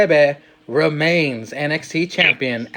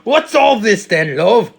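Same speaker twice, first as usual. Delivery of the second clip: slow, higher, louder cockney copper voice; English accent; 5 semitones higher, 4 dB louder, slower than usual. Retold or imitated. A podcaster doubling down with a British bobby accent. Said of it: "was that a fucking English accent".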